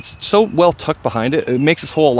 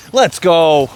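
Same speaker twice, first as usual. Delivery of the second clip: excited cheer. Real, not acted. Cheering his own putt on the course, excited. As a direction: pump yourself up after sinking it.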